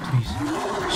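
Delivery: breathy, toneless plea; whisper. Real, not acted. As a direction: whisper while hiding. Hiding from monster.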